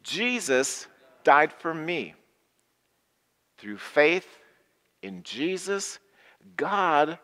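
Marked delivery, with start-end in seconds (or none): 0.0-2.2 slow unison recital. Acted. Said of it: "Say that with me".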